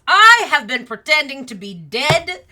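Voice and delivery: high, loud, laughing rant; manic shouting. Acted. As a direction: rave about it with wild glee. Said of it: "and the Mad Hatter goes".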